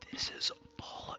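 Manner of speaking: hushed, slow in-character whisper; whispering under his breath. Acted. Cole looking around the trophy room, whispering. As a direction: murmur it to yourself in awe. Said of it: "whispering under his breath".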